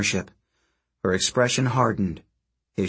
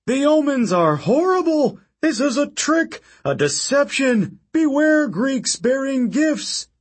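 high, loud, slowing frantic rant; raging warning; 13 semitones higher, 3 dB louder than usual. Acted. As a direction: rant like a doom prophet. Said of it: "His face was red with rage".

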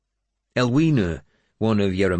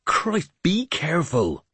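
high, drawn-out, exasperated snarl; 11 semitones higher than usual. A narrator's reading. Finan warns a man handling the wounded lord, snarling. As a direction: snarl it out slowly, pitched up. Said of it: "Finan snarled at him".

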